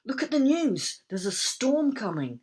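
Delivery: high, loud, urgent; urgent alarm. A narrator's reading. Urgent news of storm.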